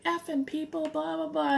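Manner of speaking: high-pitched imitation of a ranting shopper; retold cursing rant. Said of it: "she's dropping, you know, F-bombs".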